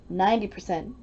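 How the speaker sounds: usual speaking voice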